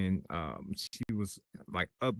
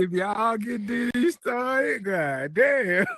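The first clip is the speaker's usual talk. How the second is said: much higher, loud, slow hype yell; excited shout; 13 semitones higher, 8 dB louder, slower than usual. Real, not acted. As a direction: yell it out like you're warning everyone he's about to blow. Hyping up a friend's temper.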